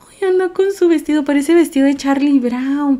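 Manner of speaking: high-pitched cooing chatter; adoring gush. Real, not acted. Streamer admiring a pet's dress, high adoring coo. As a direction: coo over something adorable. Gushing over the animal's dress.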